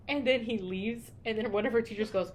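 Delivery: ordinary delivery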